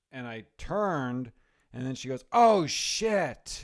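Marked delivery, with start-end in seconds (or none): 2.3-3.6 sudden high, loud "oh, shit"; startled curse. Retold or imitated. Wife spotting the camera at the red light, startled. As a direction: caught off guard, blurting it out.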